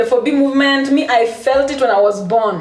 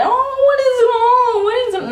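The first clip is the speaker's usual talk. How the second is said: high fake-worried friend; mock concerned friend; 11 semitones higher than usual. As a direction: squeak it like a nosy friend fussing over you. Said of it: "you asking me like".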